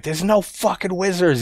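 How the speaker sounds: high-pitched exasperated dad, not really yelling; jumped-up pitch, half-volume yell; mock scream. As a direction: imitate a dad losing it at his kids. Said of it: "He's screaming at the kids".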